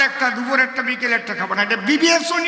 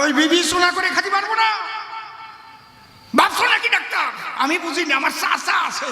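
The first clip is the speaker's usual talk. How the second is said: high, shouting protest at the doctor; patient shouts at the doctor, mock indignant; mock angry shouting; 7 semitones higher than usual. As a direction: yell your refusal at the doctor.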